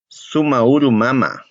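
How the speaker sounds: "sumauru mama" said slowly; slow Aymara greeting. Shown as a demonstration. Teacher models greeting mother slowly. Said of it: "Sería".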